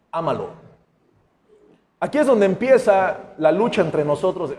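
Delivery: normal talking voice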